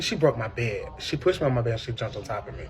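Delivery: normal talking voice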